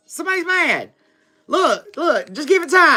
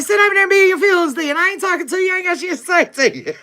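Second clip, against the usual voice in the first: fast, higher, sassy mock retort; dismissive brush-off; 6 semitones higher, faster than usual. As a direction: toss it off like you're done with them. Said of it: "You're like".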